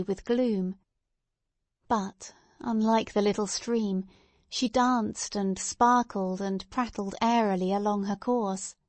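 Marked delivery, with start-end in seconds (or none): none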